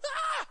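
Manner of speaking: short, very high squeal of fright; a sudden high "Ah!" like a frightened toddler; imitated toddler squeal. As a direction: squeal like a scared two-year-old. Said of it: "she squealed".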